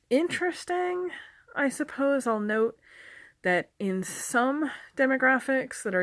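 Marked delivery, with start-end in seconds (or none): 0.1-2.3 wry interesting. Real, not acted. Dry remark on confusing usage.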